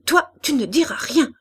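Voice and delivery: put-on high, loud, choked child's defiance; angry stammer. A narrator's reading. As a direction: forbid it in mounting childish fury. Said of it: "bégaya-t-elle".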